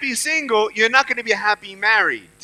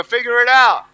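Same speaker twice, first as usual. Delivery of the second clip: louder, forceful rallying call; stronger; 7 dB louder than usual. Shown as a demonstration. Speaker urging audience to repeat the words, stronger. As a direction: punch it out for the crowd to echo. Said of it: "Come on, say it stronger".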